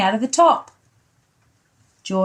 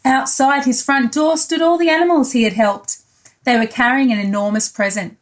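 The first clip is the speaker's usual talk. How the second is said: ordinary delivery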